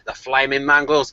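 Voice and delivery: a hint of an Aussie accent on a cursed phrase; Australian accent. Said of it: "I have to get into the zone to do an Australian".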